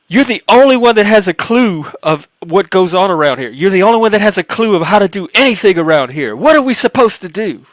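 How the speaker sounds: high, loud, hurried exasperated rant; retold angry rant. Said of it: "She was pissed".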